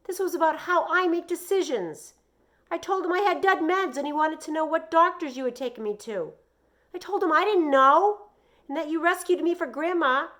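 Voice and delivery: put-on high, loud, frantic boy's outburst; shouting. A narrator's reading. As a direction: pour it all out at full volume. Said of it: "I shouted".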